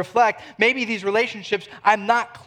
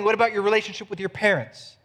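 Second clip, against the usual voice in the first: ordinary delivery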